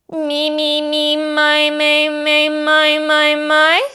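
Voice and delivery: high, loud, ringing me and my; high resonant vocal exercise. Shown as a demonstration. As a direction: climb high without falsetto. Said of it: "Try not to go into falsetto".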